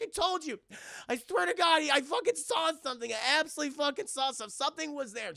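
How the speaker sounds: high and loud, desperate; shaken, insisting she saw it; frantic insisting. Retold or imitated. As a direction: plead to be believed. Mother insists she saw something.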